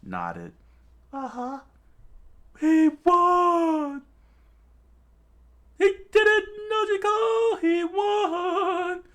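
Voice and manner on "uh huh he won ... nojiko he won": high, loud, overjoyed shout through tears; tearful cry of joy. Acted. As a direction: shriek the news in happy tears. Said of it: "completely in tears".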